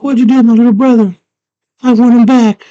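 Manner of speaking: high, loud frantic shout; shrieked mock confrontation; angry demand. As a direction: imitate a girl screaming at her brother's kidnapper. Girl confronts goblin king over brother.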